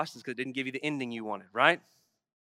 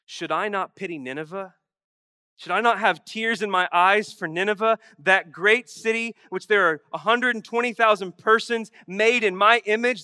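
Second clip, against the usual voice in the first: a hint of God's pleading voice, building louder; 6 semitones higher, 10 dB louder than usual. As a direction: let God's plea swell toward my image. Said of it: "And God says".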